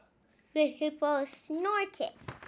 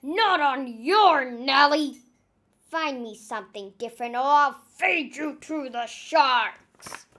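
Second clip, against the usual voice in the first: furious captain roar; 9 dB louder than usual. Acted. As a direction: threaten the crew with the sharks. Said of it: "he roared".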